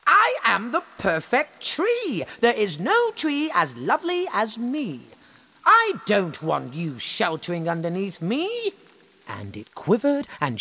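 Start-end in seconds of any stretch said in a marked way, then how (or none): 0.0-8.7 higher, louder haughty tree voice; vain tree. Acted. The tree refusing animals, haughty and high. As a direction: sniff it out haughtily. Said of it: "said the oak tree".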